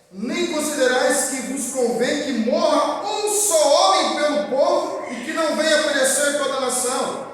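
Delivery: loud, high declaimed Bible verses; forceful scripture reading. Real, not acted. Preacher reading the Caiaphas verses to the church, forceful scripture declaiming. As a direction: proclaim the scripture to a whole church.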